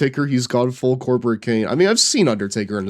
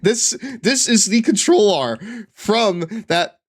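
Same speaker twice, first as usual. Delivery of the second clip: laughing; 10 semitones higher, slower than usual. Real, not acted. Laughing explanation.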